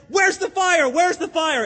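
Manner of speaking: high, loud cry of alarm; shocked shouting. Retold or imitated. Preacher acts the sleeper woken by Wesley's yell, shocked shouting. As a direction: shout it like someone jolted awake. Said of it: "wakes up in a shock".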